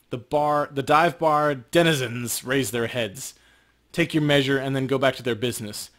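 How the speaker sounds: game master sets the bar scene, evenly paced; lightly dramatic game master read; scene narration. A narrator's reading. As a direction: paint the dive bar for the players.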